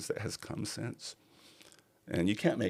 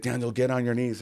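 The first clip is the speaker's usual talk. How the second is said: bossy friend; 7 semitones higher, 5 dB louder than usual. Retold or imitated. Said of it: "She's like".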